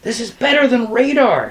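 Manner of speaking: high, thrilled exclamation; moderately loud, high cry; excited shout. A narrator's reading. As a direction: call it out high, amazed. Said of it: "shouted Kresge".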